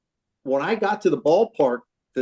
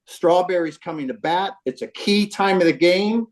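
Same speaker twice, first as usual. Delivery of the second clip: normal talking voice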